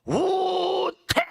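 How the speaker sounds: high, very slow, drawn-out cry; forceful. Shown as a demonstration. Preacher showing how the Greek word sounds said hard, forceful. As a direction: stretch the word out at the top of the voice's pitch. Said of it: "세게 얘기할 때 얘기하는 거예요".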